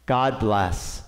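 ordinary talk

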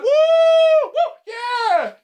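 high, loud whoop; cheering. Real, not acted. Creator cheering for subscribers, high whoops. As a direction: whoop it out for the milestone. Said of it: "celebrating four thousand subscribers".

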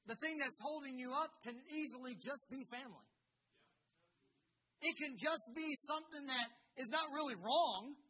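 loud, high-pitched sermon delivery; forceful preaching. Real, not acted. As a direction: drive the point home to the congregation. Preaches to the congregation.

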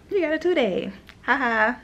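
high, loud mocking sing-song; mocking scheduler taunt. Mimicking crew scheduling gloating about the extra trip, mocking and loud. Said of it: "and then be like".

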